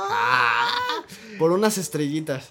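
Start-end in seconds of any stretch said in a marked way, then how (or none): 0.1-1.0 sudden squeaky shout; high yelp. Real, not acted. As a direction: let out a sharp high yelp.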